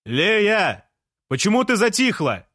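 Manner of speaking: very high, carrying call; shouted questions. A narrator's reading. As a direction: yell up to the window for an answer. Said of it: "крикнул Жан".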